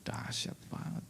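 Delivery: soft, breathless whisper of awe; awed whisper. Real, not acted. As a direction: let the words fall away, moved. Moved by daughter's gesture.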